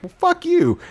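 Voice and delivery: very high, dismissive comeback; mock defiant retort. Girls answering the doll's threat, mock defiant. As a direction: fire it back in a leaping high pitch.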